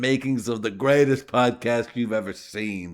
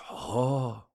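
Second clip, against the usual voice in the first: mock old Japanese accent, pitched higher; 4 semitones higher than usual. Xi Jinping invites the actor to China, mock Japanese accent. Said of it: "I'm gonna give him an old Japanese accent".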